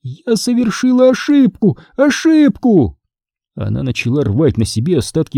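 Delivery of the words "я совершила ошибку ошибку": very high ghostly lament; hammed-up high-pitched despair; frantic ghost. A narrator's reading. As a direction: wail it high, stretching the last word. Ghost frantic over her mistake.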